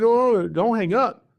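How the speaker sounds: a retold, pressing no no no; urgent plea. Snake catcher urging the caller to stay on the line, urgent. Begging caller not to hang up.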